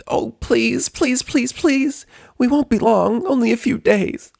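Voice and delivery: very high, pleading squeak; Pinkie begging. Acted. Pinkie begs the doctor, high squeaky pleading. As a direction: beg over and over in a tiny high voice. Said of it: "Pinkie tried next".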